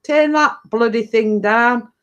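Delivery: half-hearted call over to the neighbour, slowly; mock shout. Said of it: "I could shout over and".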